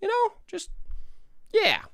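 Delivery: squeaky high put-on voice; lightly voiced squeaky mock voice; a goofy high voice for a joke; silly voice.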